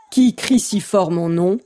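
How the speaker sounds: slow grandmother voice; lightly voiced old grandmother; old woman voice. A narrator's reading. (voix de grand-mère)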